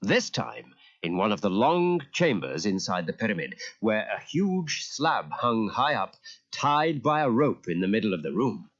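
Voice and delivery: plain reading voice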